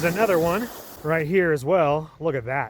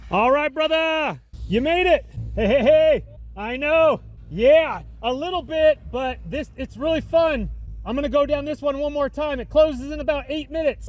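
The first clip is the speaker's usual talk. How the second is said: excited greeting; 13 semitones higher than usual. Real, not acted. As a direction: shout up to your buddy on the slope. Greeting a friend on the slope.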